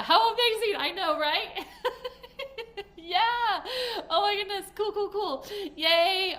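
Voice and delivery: high, laughing, gushing delight; excited squealing. Real, not acted. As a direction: squeal with joy.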